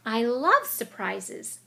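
squeaky Mouse. Acted. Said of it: "squeaked Mouse".

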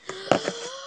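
high wordless squeal; ear-splitting shriek. Acted. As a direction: let out a piercing cartoon shriek. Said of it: "You broke my eardrums".

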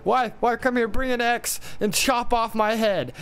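the tiger bawls for his wife to bring an axe; bawling. A narrator's reading. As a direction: bellow for the wife across the house. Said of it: "began to bawl for his wife".

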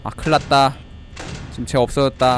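monotone robot reporting trouble. Pretend. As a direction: keep the alarm out, machine-like monotone. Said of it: "난 기계다".

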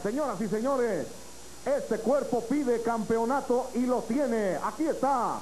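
high, drawn-out announcer build-up; lucha libre announcer. Acted. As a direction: sell the champion to the crowd. Presenting the champion to the crowd.

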